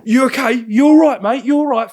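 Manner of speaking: high, loud repeated check; agitated concern. Retold or imitated. Other driver checking on him after the crash, agitated and loud. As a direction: call out urgently, pitched well up.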